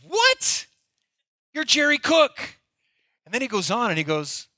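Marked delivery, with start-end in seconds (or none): 0.1-2.6 high-pitched squawk of disbelief, retold; mock incredulous outburst. Young pastor reacting to his hero's confession, mock incredulous outburst. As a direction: blurt it out high, as if your hero just let you down.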